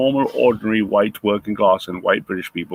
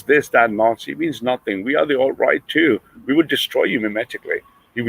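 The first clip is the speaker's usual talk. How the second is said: ordinary talk, nothing marked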